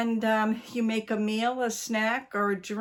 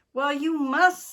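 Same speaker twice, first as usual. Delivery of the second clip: firm and much louder, pitched higher; 7 semitones higher, 7 dB louder than usual. A narrator's reading. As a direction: lay down the law firmly. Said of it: "said Missus Twiddle firmly".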